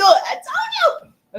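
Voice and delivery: high, loud, drawn-out singsong; gleeful crowing. Real, not acted. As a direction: crow it triumphantly, stretching it out. Gleeful hype to viewers.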